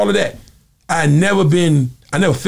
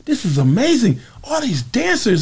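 lightly voiced Michael, delighted; 9 semitones higher than usual. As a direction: gush like a big kid. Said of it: "He's like".

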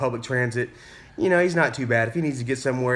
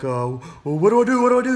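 high, loud imitation of a panicking driver; tense, repeated pleas in mock panic; Michael all tensed up; 8 semitones higher, 5 dB louder, faster than usual. Learner driver with a car behind him, imitated in high, tense panic. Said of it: "He's all tensed up on the wheel".

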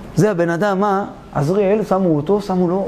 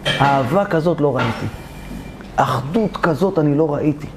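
much lower, measured royal voice; king's voice; 8 semitones lower, 3 dB quieter than usual. Acted. King releasing the two friends, in a low regal voice. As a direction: speak down low like a grave king. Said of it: "אמר להם המלך".

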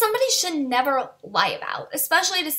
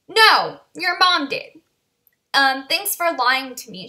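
retold anger; 3 semitones higher, 4 dB louder, slower than usual. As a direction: throw the accusation back, hitting the first word hard. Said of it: "I was so mad".

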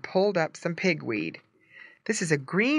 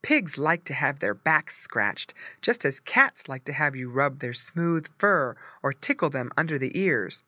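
ordinary delivery

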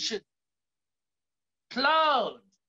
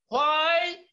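ordinary talk, nothing marked

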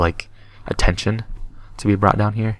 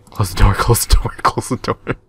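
nervous laughing; 2 semitones higher than usual. Real, not acted. Panicked laughing at game.

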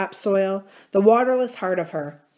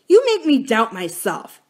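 louder, higher fierce voice; fierce and accusing, louder; 4 semitones higher, 6 dB louder than usual. A narrator's reading. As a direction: hurl it at him fiercely. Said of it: "he said fiercely".

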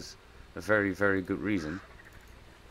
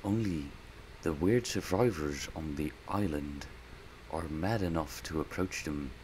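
documentary narrator; 3 semitones lower, 5 dB quieter than usual. Acted. Gamer describing the creature as a nature documentary narrator.

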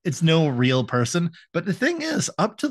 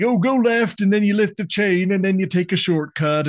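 eager directions; 7 semitones higher, 3 dB louder than usual. Retold or imitated. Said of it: "And he's like".

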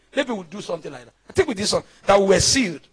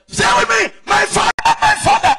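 very high, loud imitation of praying believers; hammed-up high prayer cry; mock prayer shout; 7 dB louder than usual. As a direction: mimic a frantic prayer leader shouting. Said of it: "when I see believers say".

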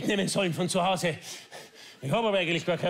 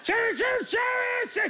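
very high, strained yelps of pain; pained screaming. Acted. Husband sprayed by his wife, shrieking in pain. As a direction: shriek like your eyes are burning.

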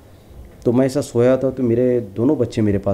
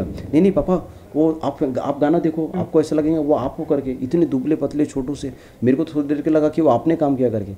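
put-on higher, eager child's voice; mimicking his son, pitched up and insistent; excited child; 6 semitones higher than usual. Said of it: "बोला।". (बेटे की ऊँची, उत्साहित आवाज़ की नकल)

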